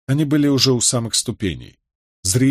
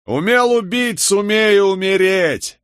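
high heckling cry, slowing at the end; barked taunt; 9 semitones higher, 3 dB louder, slower than usual. A narrator's reading. Someone in the crowd taunting the condemned, barked shout. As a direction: jeer it across a crowd, pitched right up. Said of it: "рявкнул кто-то в толпе".

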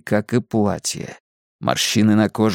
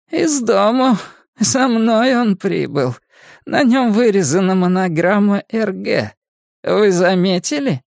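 put-on creepy old Mrs. Kersh; 8 semitones higher than usual. A narrator's reading. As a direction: tell it as an uncanny crone, pitched high. (зловещая старуха высоким голосом)

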